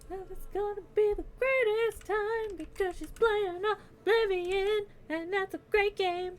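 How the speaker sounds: thrilled about the raid; gushing excitement. Real, not acted. About to raid friend's Oblivion stream.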